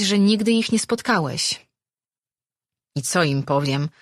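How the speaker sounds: ordinary delivery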